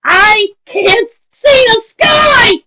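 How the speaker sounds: high, loud, very slow crying scream. A narrator's reading. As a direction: scream it at the top of her lungs. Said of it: "yelled at the top of her lungs".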